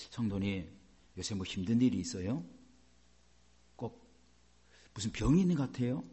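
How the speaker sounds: low, quiet probing question to a church member; lightly acted, softly planting a worry; concerned acquaintance. Preacher suggests to a church member that they look ill, quietly concerned. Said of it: "제가 막 그렇게 얘기해 봅시다".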